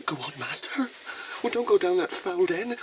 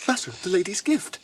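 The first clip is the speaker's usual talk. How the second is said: ordinary delivery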